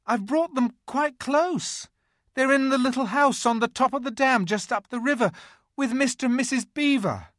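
put-on high, anxious boy's voice; pleading boy. A narrator's reading. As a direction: plead nervously with the queen. Edmund pleading to witch.

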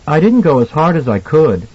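put-on a mild, boyish confession; confessing child. A narrator's reading. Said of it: "Danny confessed".

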